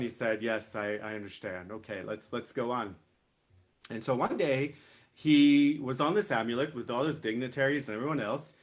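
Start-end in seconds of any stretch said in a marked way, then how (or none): 0.4-3.0 low, soft, subdued acceptance; humbled. A narrator's reading. King accepts the wind's rebuke, humbled and subdued. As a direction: give in quietly, head down. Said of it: "He put his head down".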